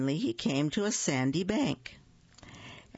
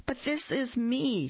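high, startled protest; surprised; 7 semitones higher than usual. Acted. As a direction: yelp it in surprise. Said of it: "very much surprised".

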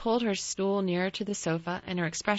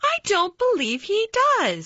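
high, loud flat contradiction; obstinate; 9 dB louder than usual. A narrator's reading. Mary contradicting Colin about his father, obstinate and loud. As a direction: refuse to accept it, firmly. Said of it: "said Mary quite obstinately".

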